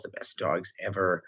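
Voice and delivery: normal talking voice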